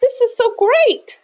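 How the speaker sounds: high, loud delight; bursting with delight; excited thought. A narrator's reading. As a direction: squeal it with joy. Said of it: "he thought".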